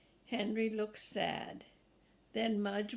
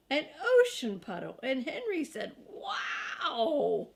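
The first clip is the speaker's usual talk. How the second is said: growing louder with each puddle; building excitement; 3 semitones higher, 4 dB louder than usual. A narrator's reading. Reading to kids, Henry thrilled at the puddle. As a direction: build it up to a delighted wow.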